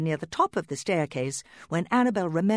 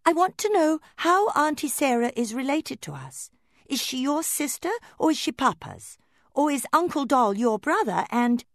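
put-on eager little doll girl; 11 semitones higher, 4 dB louder than usual. Annabel rattles questions.

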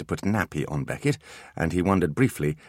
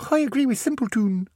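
put-on high small-boy voice; a young boy asking eagerly; child voice. Miles asks Artemis, in a high child voice.

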